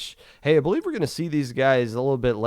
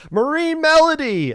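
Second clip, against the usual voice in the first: dramatic callout; 13 semitones higher, 6 dB louder than usual. Real, not acted. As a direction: announce it with mock dread. Dramatic team intro.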